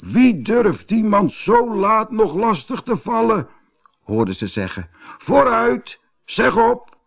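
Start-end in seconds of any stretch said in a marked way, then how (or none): 0.0-3.5 high, sharp challenge to late callers; indignant Das. A narrator's reading. 5.2-7.0 high, impatient demand; indignant Das. A narrator's reading.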